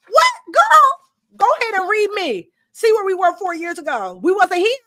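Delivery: shrieking mock outrage from the journal; sassy journal voice. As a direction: play the journal calling her out in disbelief. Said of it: "your journal is like".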